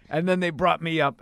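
ordinary talk

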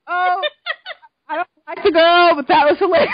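high, loud words breaking into laughter; cracking up. Retold or imitated. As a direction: shout it through helpless laughter. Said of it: "That was so funny. I was cracking up".

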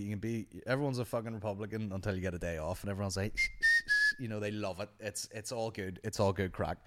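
normal talking voice

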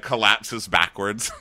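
ordinary delivery

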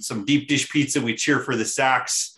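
hammed-up Chicago local's accent; terrible Chicago accent. Introduced guest plays the beer-drinking local in a mock Chicago accent. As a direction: do a badly faked Chicago accent. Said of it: "that's my terrible Chicago accent".

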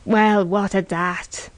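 slow, indifferent retort; unmoved. A narrator's reading. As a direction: shrug it off, drawn out. Man unmoved by her weeping.

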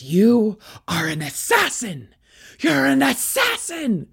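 imitating an irate dad, pitch leaping on the repeat; mock accusing yell. Dad accuses his daughter, imitated accusing yell.

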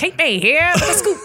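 high, loud newscaster with laugh; news radio host. Pretend. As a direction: pitch the scoop like a radio anchor. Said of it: "news radio host kind of".

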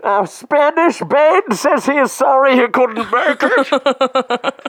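high, strained mock Bane voice. Greeting the other Banes in a hammed-up high Bane voice. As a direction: ham up a squeaky Bane.

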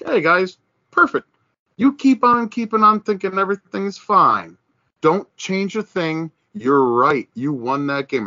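high, sarcastic "perfect" that mocks the fans. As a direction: pile on the fake praise. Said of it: "I'm sitting there going like".

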